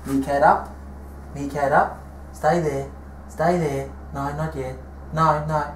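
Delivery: quiet pet commands; talking to the dog. Acted. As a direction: coax the dog to stay.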